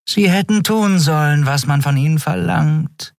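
high-pitched purring villainess. A narrator's reading. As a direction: purr it smugly from behind the victim. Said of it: "schnurrte Shiar hinter seinem Rücken".

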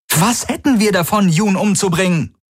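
hohe, verzweifelt empörte Frage; aufgebrachtes Rufen. A narrator's reading. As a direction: aufgebracht zurückfragen, sehr hoch. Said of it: "rief Kirai". (empört rufend)

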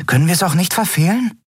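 high, quick little voice; small winged creature. A narrator's reading. Small companion anxiously asks Kai, high and quick. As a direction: chirp the worry out fast.

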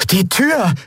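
high-pitched alarmed exclamation; ausgerufen. A narrator's reading. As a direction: cry out in sudden realisation. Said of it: "rief Miko aus". (erschrocken hoch ausgerufen)

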